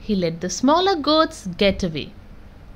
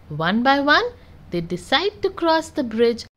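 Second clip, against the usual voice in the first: ordinary delivery, nothing marked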